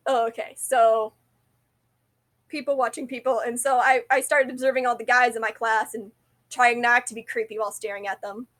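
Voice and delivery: normal talking voice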